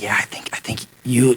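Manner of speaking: whispered urging. Retold or imitated. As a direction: keep it hushed. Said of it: "I whisper".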